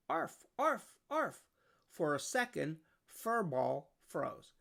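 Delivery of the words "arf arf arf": high yappy barks; puppy barking. A narrator's reading. Storyteller barks as the puppy near the frightened cat. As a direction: bark like an eager puppy. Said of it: "Spark started to bark".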